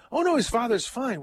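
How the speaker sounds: retold "oh no, not at all" reassurance; breezy correction. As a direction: brush off the concern on a lifted pitch. Office worker dismisses colleague's worry.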